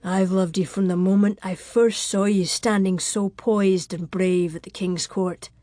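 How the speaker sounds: heartfelt, husky lover's vow; tender, earnest, emotion-filled declaration. Acted. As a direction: confess love in a character man's voice. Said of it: "his voice husky with emotion".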